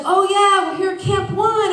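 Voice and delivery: high, loud cheerful announcement. Retold or imitated. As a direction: proudly announce the camp. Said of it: "and he's like".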